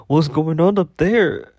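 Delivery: high, loud mocking coworker voice; the new coworker gawking at Renee's hair, high and loud; Shalina's voice. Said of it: "you have to hear it in her voice".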